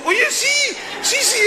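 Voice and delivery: high, laughing mock shout; excited recognition. Preacher answering his friend Toño, excited and laughing. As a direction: squeal it through laughter. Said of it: "Y yo".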